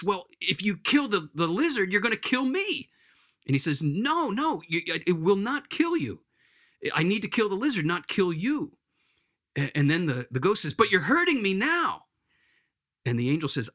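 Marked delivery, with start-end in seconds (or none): 0.0-2.9 high worried protest, climbing at the end; acted alarm. Ghost fearing the angel will kill him too, acted alarm. Ghost fears being killed. 3.8-8.8 insistent denial. Acted. Angel reassures the panicking ghost. 10.7-12.1 high, loud acted wail of complaint; pained protest. Ghost complaining to the angel about being hurt, pained protest.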